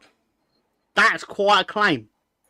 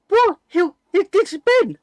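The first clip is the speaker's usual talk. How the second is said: indignant high protest; 7 dB louder than usual. Real, not acted.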